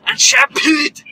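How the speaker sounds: loud, slowing Scottish dwarf lecture; Scottish dwarf accent. Acted. Friend teasing about dwarf women, hammed-up Scottish accent. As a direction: lay it on thick as a boastful Scottish dwarf. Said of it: "I can see the accent".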